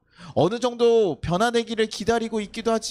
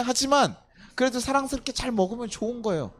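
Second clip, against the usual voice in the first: ordinary delivery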